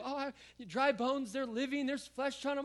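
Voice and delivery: high, giddy mock excitement; mock thrilled churchgoer. Pastor mimics people who love the miracle story, high and giddy. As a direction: gush like a fan of the miracle. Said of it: "we love that story of".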